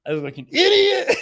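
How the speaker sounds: squeaky, half-laughing mock of a humiliated husband; mock husband whining. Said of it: "the husband's like".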